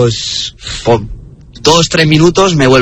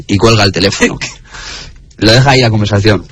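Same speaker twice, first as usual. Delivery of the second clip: ordinary talk, nothing marked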